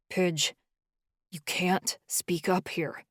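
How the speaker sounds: slow, strained and held back. A narrator's reading. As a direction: force the words out slowly, straining. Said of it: "Charlie said in a strained voice".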